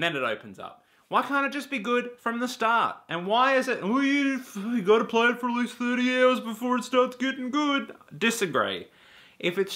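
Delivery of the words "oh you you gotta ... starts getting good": high sing-song mockery of a fan; mocking fan voice. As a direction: do a higher, silly voice for the fan's excuse. Mocking fans' excuse.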